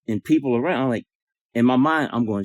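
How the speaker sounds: ordinary talk, nothing marked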